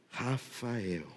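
very soft, low call of a name; hushed, merciful address; tender calling. Retold or imitated. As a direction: breathe the name gently, far below preaching level. Jesus mercifully calls her by name.

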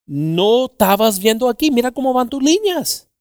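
acted high-pitched reproach; retold scolding. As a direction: imitate a boss pointing out the mistake. Retold man scolding the driver.

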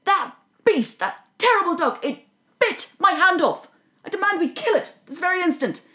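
hammed-up furious villain shouting; furious demand. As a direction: storm in raging at the king. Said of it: "Red, who is furious".

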